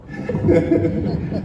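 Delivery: loud breathy laugh; burst of laughter. Real, not acted. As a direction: laugh out loud at your own joke. Laughs at own joke about age.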